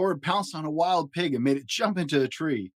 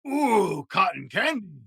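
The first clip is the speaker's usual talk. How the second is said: high, loud greedy ooh; greedy delighted monster; 6 semitones higher, 3 dB louder, slower than usual. A narrator's reading. Big monster spotting Little Shock as a sweet snack. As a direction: drool over a tasty treat. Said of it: "he said".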